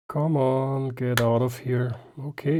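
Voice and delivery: usual speaking voice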